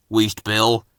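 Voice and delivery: ordinary delivery, nothing marked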